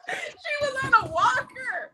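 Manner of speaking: high, drawn-out laughing cry; helpless high-pitched laughter in the words; real laughing protest. Real, not acted. Laughing at absurd rebuke suggestion.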